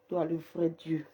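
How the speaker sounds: ordinary delivery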